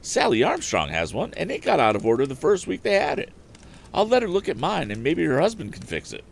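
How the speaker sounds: high chatty woman's voice; high-voiced neighbourly farm woman; farm wife voice. A narrator's reading. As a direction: gossip eagerly about the neighbour, pitched high. Farm wife keeps talking.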